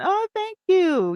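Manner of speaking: high, slow delighted thanks; touched high thanks. Real, not acted. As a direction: gush your thanks slowly. Viewer compliments her.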